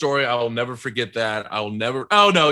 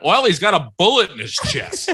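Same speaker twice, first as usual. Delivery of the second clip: higher sarcastic swing on the line; 5 semitones higher, 4 dB louder than usual. Acted. Acting student retaking paramedic line for teacher, sarcastic. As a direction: unable to sound concerned, mock-sarcastic. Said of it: "It was, like, so sarcastic".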